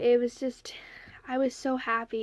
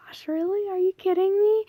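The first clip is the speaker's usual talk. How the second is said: breath, then a high, loud burst of disbelief; rising from a hush to a thrilled squeal; retold excitement; 9 semitones higher, 7 dB louder, faster than usual. Re-living opening the big gift, building excitement. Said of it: "I'm just like".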